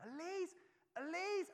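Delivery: high, slow shout across the shop; yelling for a lost child; panicked father shouting her name; frantic calling. Retold or imitated. Storming around the shop calling.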